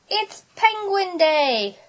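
high, drawn-out happy cry; excited cry. A narrator's reading. Splat waking up on zoo day, excited cry. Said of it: "cried Splat".

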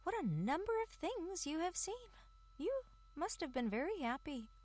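high squeaky mouse voice, impressed; admiring mouse voice. Acted. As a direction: marvel like a little mouse. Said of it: "they said".

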